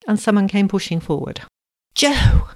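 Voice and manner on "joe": high, loud cry of relief; emotional high exclamation, acted relief; sobbed-out relief. A narrator's reading. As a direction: cry out as rescue arrives. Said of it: "Susie sobbed out her relief".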